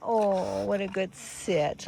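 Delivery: normal talking voice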